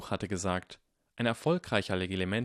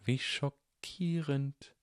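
slow mock shock; cold sarcastic drawl; dry voice; 6 semitones higher, much slower than usual. A narrator's reading. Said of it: "sagte eine trockene Stimme".

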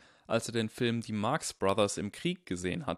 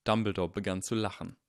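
plain reading voice

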